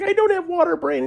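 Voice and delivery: very high, loud relieved cry; elated. Retold or imitated. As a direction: blurt out the good news, thrilled. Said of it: "And I'm like".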